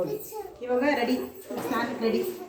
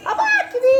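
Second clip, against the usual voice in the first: pained cry; 7 dB louder than usual. Real, not acted. Pained cry over ear.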